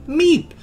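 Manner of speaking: tiny, very high squeaky meep; sharp high mock squeak; hamster meep imitation. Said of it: "and then stopping and going".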